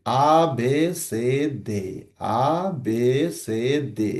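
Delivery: আ, বে, সে, দে spaced out letter by letter; teacher reciting the Portuguese alphabet aloud. Shown as a demonstration. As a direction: chant the letters slowly like for children. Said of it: "আমি যদি এখন একটু বলার চেষ্টা করি".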